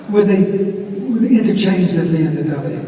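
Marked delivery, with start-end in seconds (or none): none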